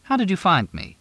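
stunned that he found her; amazed. A narrator's reading. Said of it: "Her eyes were round in amazement".

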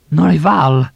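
loud, high panicked shout. Acted. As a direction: scream the name in alarm. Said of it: "Ava was shouting".

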